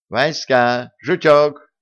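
calling the pets from another room; calling from afar. A narrator's reading. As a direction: raise the voice to carry across the yard. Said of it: "донёсся до них голос Янки".

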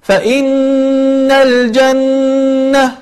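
فإن and الجنة with each doubled n hummed long; slow chanted ghunna; held nasal ghunna. Shown as a demonstration. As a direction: stretch both nasal doubles slowly. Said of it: "فتقول".

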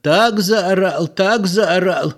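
high, slow drawn-out marveling; marveling villager. A narrator's reading. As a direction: stretch it in awe.

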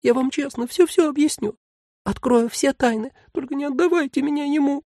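very high, thin pleading voice; a tiny creature begging to be heard; plaintive tiny voice. Acted. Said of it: "жалобный тоненький голосок".

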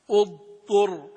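اضطر with an audible ض before the ط; clear ض before ط. Shown as a demonstration. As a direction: sound the ض in اضطر, then the ط. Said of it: "أظهر الضاد مع الطاء في". (إظهار الضاد في اضطر)

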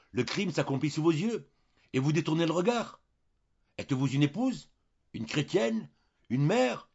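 loud, high, rising reproach; indignant exclamation. A narrator's reading. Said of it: "s'écria". (s'écrier avec indignation, de plus en plus haut)

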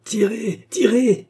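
high-pitched frantic yell; wild shouted urging, rising in force; howling. A narrator's reading. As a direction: howl the order like a madman. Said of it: "hurla l'idiot".

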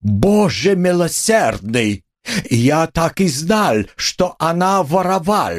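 put-on high, slow exclamations with a German accent; scandalized landlady. A narrator's reading. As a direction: wail it out in theatrical outrage. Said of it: "всплеснула руками Амалия Ивановна". (причитание возмущённой хозяйки-немки)

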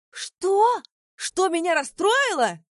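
put-on slurred drunken shout, high and slow; drunk yelling. A narrator's reading. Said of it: "проорал Вилли заплетающимся языком".

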